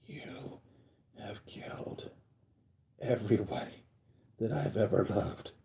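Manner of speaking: hoarse, breathy, seething whisper; quoting Scarlet Witch in a fierce whisper; furious whisper. Retold or imitated. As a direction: whisper it with pent-up rage. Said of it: "she's so pissed at Thanos".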